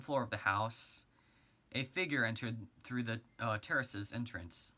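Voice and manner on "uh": plain reading voice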